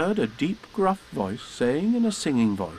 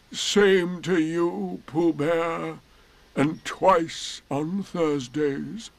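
very slow, drawn-out doleful reply; gloomy; 5 semitones higher, much slower than usual. Acted. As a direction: answer with heavy, plodding gloom. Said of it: "said Eeyore gloomily".

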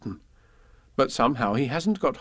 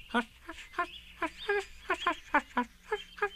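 tiny high whispered hushes; very quiet hushing; 9 dB quieter than usual. Acted. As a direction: Roo hushing himself over and over. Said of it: "several times to himself very quietly".

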